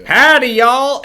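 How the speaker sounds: high, loud Southern howdy; hammed-up Southern US greeting; Southern accent. Shown as a demonstration. Podcaster showing the easiest accent, loud Southern accent. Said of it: "Oh, and the southern one".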